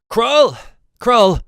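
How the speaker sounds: loud, high-pitched shout for a servant; yelling. Acted. Soldier summons the butler at the door, yelling. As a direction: yell the name out at the door. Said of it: "he yells inside, or, well, yells at the door".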